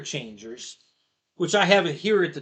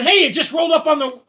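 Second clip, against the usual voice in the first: retold call; 10 semitones higher, 7 dB louder, faster than usual. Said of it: "and they said".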